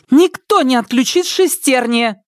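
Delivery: high, sharp bark of defiance; girl defending the robot with a bark. Acted. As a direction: snap it, jumping up. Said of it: "рявкнула Ника".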